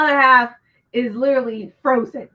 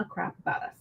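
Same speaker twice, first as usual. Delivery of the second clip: whispered crap; 6 semitones lower, 11 dB quieter, faster than usual. Real, not acted. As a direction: whisper "a crap about us" under the breath. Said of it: "I need to stop cussing".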